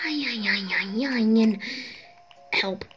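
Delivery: slow whispered disbelief; whispering. Real, not acted. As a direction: breathe it out in awe. Overwhelmed by item count.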